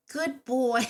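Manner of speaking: mock-cheerful, effortful dog praise; forced praise. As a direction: praise the dog against your true feelings. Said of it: "it took everything in my power".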